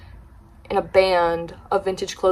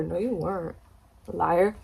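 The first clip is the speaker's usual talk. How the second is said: muttered retort; 3 semitones lower, 8 dB quieter than usual. Retold or imitated. Retorting under breath.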